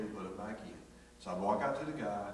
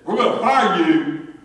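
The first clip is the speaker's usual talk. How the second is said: pretend angry shout; 8 semitones higher, 18 dB louder, slower than usual. What he could have yelled.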